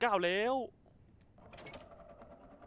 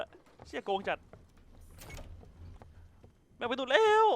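excited shouting; 6 semitones higher, 4 dB louder than usual. Real, not acted. Monster breaks door, alarmed shouting.

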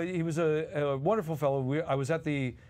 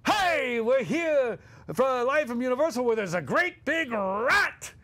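much higher and louder, hammy host voice; manic on-air outburst; 9 semitones higher, 4 dB louder, slower than usual. Retold or imitated. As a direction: burst onto live air going off script. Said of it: "he goes off".

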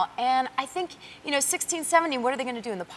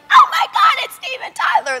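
high, loud squeal of a starstruck fan; 8 dB louder than usual. Retold or imitated. Guest retelling meeting [name], squealing high and loud. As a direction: shriek his name like a giddy teenager.

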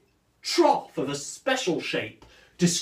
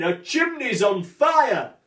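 slow, higher, louder panicked shout; mock scream; 8 semitones higher, 5 dB louder than usual. Neighbours choking on smoke, shouting in mock panic. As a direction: yell it like the neighbours fleeing. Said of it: "run round screaming".

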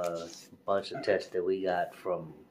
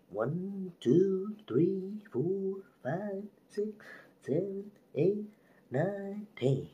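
high counting; 12 semitones higher, slower than usual. Real, not acted. Counting while twirling the swab.

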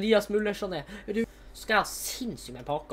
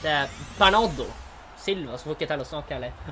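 normal talking voice

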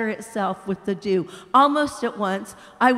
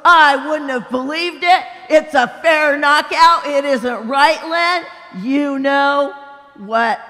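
big ghost, indignant; 3 semitones higher, 8 dB louder than usual. Acted. Big ghost shouting at the bright spirit, loud and outraged. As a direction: bellow it like an aggrieved bully.

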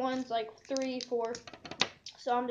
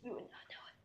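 whisper; 18 dB quieter, faster than usual. Real, not acted. Whispered while in pain.

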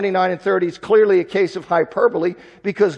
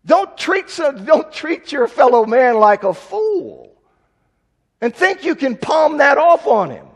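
high, knowing, teasing voice; knowing tease; 7 semitones higher, 3 dB louder than usual. Real, not acted. Preacher teasing the congregation who know better, knowing high voice. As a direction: wink at the men in on it.

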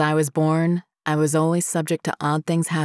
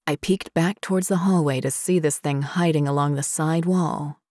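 plain reading voice

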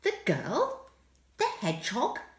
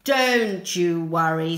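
deep reassuring man; 6 semitones lower, 6 dB louder than usual. Acted. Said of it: "said the man with the sniffy dog".

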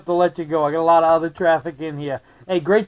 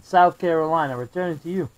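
ordinary delivery

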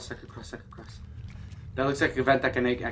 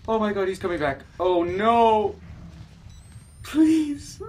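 panicked pleading; 7 semitones higher than usual. Real, not acted. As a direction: cry out in fear. Monster returns, panics.

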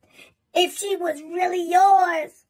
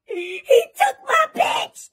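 mock sob; 5 semitones higher, 4 dB louder, slower than usual. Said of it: "He said".

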